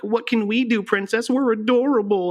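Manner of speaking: high squeaky cute voice; high cutesy character quote; cutesy Toad voice. Retold or imitated. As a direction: mimic a tiny adorable mushroom person. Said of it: "It's like".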